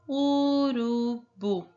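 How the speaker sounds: "Urubu" stretched slowly, syllables apart. Shown as a demonstration. Teacher putting the syllables back together. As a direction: draw out "Urubu" evenly. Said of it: "qual é a próxima sílaba".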